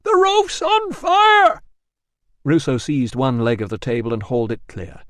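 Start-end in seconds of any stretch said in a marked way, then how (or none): 0.0-1.6 very high, loud, slow panicked yell; panicked shout. A narrator's reading. Catavignus yelling as the roof burns. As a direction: scream it in terror. Trapped man, roof burning.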